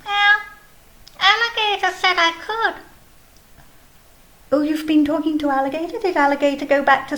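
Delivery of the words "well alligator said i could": high, cheeky little snail voice counting; Snail puppet voice. Acted. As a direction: play a chirpy snail puppet showing off.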